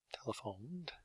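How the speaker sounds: whisper. Shown as a demonstration. Writing word on board.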